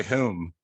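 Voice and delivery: HOME with a fronted "heh-oom" vowel; Pennsylvania "home". Retold or imitated. Host imitates how Pennsylvanians say it, HOME as "hewm". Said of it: "when I hear someone say it in Pennsylvania".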